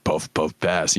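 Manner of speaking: lightly voiced low robot leader; Optimus Prime. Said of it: "talking in his prime voice".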